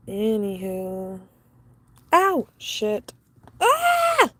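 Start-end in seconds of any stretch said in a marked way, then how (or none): 2.1-4.3 sharp yelp of sudden pain; burnt finger pain cry. Real, not acted. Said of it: "literally just burnt my finger".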